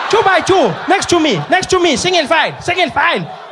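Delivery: high, loud shouts; panicking teacher shout. Retold or imitated. Teacher shouting at kids who won't line up, high and loud. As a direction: shout for order, desperate. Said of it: "She was like".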